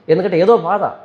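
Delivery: normal talking voice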